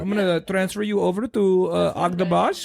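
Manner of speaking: playful exaggerated Indian accent; thick Indian accent. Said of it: "like, a really thick Indian accent".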